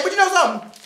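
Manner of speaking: normal talking voice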